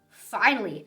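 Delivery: ordinary delivery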